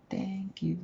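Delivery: high, soft thank-you; modelled thank-you. Shown as a demonstration. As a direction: prompt a toddler softly in a high voice.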